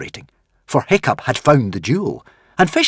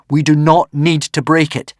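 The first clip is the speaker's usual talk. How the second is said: higher, louder earnest plea; 7 semitones higher, 7 dB louder than usual. Acted. Hiccup to the dragon, pleading earnestly. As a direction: plead for peace.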